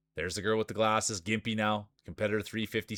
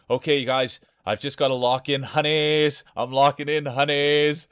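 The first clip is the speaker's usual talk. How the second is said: higher and louder mock Blondie voice, drawn-out honeys; Blondie impression; 5 semitones higher, 8 dB louder than usual. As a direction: ham up a chat regular's sweet bossy voice. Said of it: "my Blondie impression is terrible".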